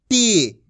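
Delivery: टी with a long, sustained ee; drawn-out long ee. Shown as a demonstration. Teacher models the syllable for learners, vowel drawn out. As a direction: hold the ee in टी. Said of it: "खींच खींच के पढ़ेंगे".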